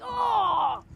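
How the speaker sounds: a shouted oh of shock; crowd wince. Acted. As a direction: wince aloud like a whole crowd. Village winces at the hit.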